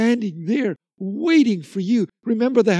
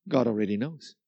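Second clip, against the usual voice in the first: usual speaking voice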